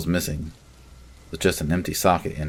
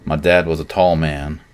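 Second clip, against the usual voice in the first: usual speaking voice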